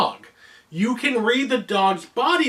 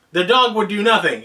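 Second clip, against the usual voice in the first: usual speaking voice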